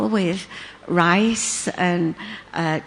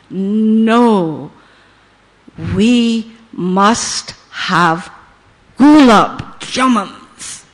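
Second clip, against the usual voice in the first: slow, louder, weighty guru's verdict; very deep voice; 5 dB louder, much slower than usual. Retold or imitated. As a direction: lay down the law slowly and firmly, like a master. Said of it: "in a very deep voice".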